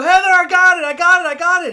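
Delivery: high, loud triumphant yelling; yelling the news to Heather, laughing; excited yell. Retold or imitated. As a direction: shout it over and over.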